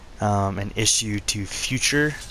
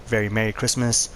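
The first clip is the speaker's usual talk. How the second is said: ordinary talk, nothing marked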